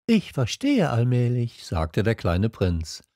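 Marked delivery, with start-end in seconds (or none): 0.1-1.6 lightly voiced little prince; a hint of a small prince's voice. A narrator's reading. Little prince answering the fox, lightly voiced.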